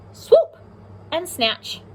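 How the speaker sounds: high, loud swooping cry; playful swoop. Acted. Teacher acts out the owl's dive for her class. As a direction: sweep the word up like a diving owl.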